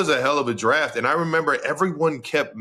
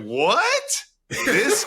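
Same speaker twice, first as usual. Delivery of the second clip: high-pitched incredulous outburst; acted-out stunned reaction, pitched way up; amazed disbelief. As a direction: squeal it in mock astonishment. Retells amazement at player's size.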